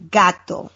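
gato said GAH-toh with open Spanish a and crisp t; Spanish pronunciation. Shown as a demonstration. Teaching the hosts Spanish, modeling gato in Spanish. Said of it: "we're gonna do a lesson, a Spanish lesson".

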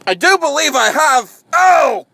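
high shout of alarm; startled scientist. Acted. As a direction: yelp it out high and loud. Scientist cries out when bitten.

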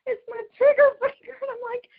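high, loud, whiny half-cry; mock wail. Real, not acted. Complaining to friends about her bitten finger, high mock wail. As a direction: wail it like a comic lament.

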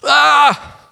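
high, short "ah" of mock horror; hammed-up yelp; mock alarmed cry. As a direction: cry out in pretend dismay. Reacts to sacrifices being offered.

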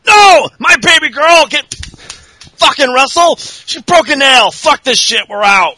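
a hammed-up furious father storming off; outraged yelling. Flair yelling at the ring for his daughter, outraged. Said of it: "Flair's all".

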